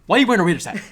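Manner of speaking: loud, high, laughing bafflement; exasperated disbelief. Real, not acted. Podcaster baffled by Cowboys fan's Raiders hat, exasperated. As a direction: exclaim it, baffled and half laughing.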